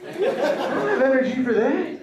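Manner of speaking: high, loud, laughing whine; whiny high protest. Retold or imitated. Comedian quotes himself refusing his girlfriend, high laughing whine. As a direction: whine like a glue-addled man.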